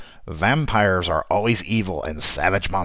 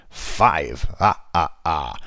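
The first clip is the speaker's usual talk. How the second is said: vampire count voice; 4 semitones lower, much slower than usual. Acted. Counting vampire facts, Count style.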